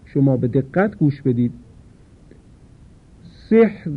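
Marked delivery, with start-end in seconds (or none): 3.2-3.9 سحر chanted higher with a light ر; سحر recited with a fine ر; recited example. Shown as a demonstration. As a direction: lift سحر and keep the ر thin.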